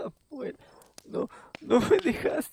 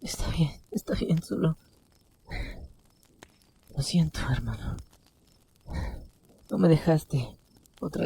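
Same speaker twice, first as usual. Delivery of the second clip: tearful apology; 4 semitones higher than usual. A narrator's reading. Brother apologizes to dying brother.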